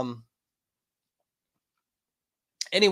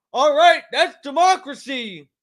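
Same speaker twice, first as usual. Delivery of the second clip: mock voter; 9 semitones higher, 5 dB louder than usual. Mocking voter's smug remark.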